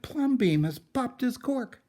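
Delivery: shocked neighbours cluck about the crazy man; scandalised neighbours. Acted. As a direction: neighbours scandalised by the painted house, high and loud. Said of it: "The people said".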